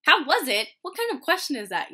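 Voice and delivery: loud, high, offended retort; mock outraged how-dare-you shout; indignant outburst. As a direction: snap back as if the question insults you. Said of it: "And I'm like".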